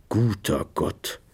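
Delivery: slow, hushed exclamation. Acted. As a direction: breathe it out softly in shock. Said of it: "sagte er mit gedämpfter Stimme".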